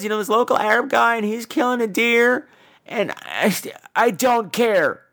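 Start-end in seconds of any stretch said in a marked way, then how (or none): none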